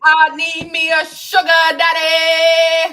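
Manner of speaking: loud, high, slow announcement; excited declaration. Real, not acted. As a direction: belt it out like a big proclamation. Declaring wish loudly.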